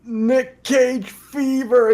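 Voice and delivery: strained high words between imagined heaves; slow, hammed-up queasy groan; mock throwing up. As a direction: act out talking while being sick. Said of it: "I just kept throwing up".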